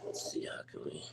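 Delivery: hushed whisper under the breath; whispering to self. Real, not acted. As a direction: whisper it quietly to yourself. Thinking aloud while searching.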